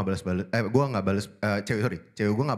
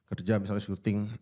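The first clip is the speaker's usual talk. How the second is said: normal talking voice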